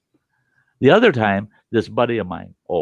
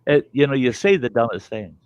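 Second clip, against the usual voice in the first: usual speaking voice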